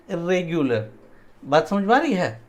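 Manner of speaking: usual speaking voice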